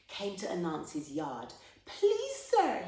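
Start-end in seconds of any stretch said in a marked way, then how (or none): 1.9-2.9 put-on very high, loud little-boy plea; begging boy. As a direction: beg like a poor child. Ragged boy begs Anansi.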